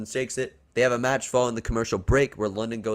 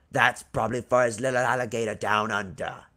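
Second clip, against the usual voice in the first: put-on Australian crocodile hunter; hammed-up Aussie voice; Aussie accent. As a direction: joke in a mock Australian accent. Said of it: "says in an Aussie accent".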